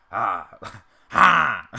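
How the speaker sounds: loud, demonstrative vocal "ha"; Uncle Ruckus impression. Shown as a demonstration. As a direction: punch out a character's verbal tic. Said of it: "He has that kind of "ha" to his voice".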